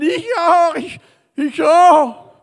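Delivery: high, loud cries of the crowd; mock crowd shout. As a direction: shout out to be healed too.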